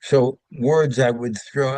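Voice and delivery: usual speaking voice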